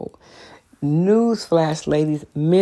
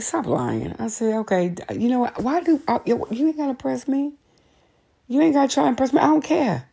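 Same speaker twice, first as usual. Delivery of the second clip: mock sassy retort; 7 semitones higher than usual. Telling off lying coworker.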